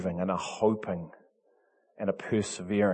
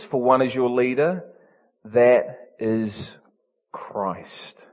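measured, emphatic Bible reading; scripture reading; 5 semitones higher, 7 dB louder than usual. Acted. Preacher reading Matthew to the congregation, emphatic. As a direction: read the verses with weight.

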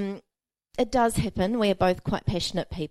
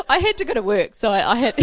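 higher, talking through laughter; laughing; 5 semitones higher, 7 dB louder than usual. Real, not acted. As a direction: try to talk while still laughing. Laughing, trying to go on.

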